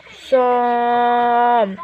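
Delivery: سون stretched very slowly, nasal on; drawn-out sound. Shown as a demonstration. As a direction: hold the sound سون long and slow. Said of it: "زعما سون". (نطق سون ببطء شديد)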